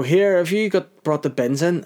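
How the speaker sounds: mock the partner yelling about the bins; partner calling out. Partner calling him about the bins, high nagging voice.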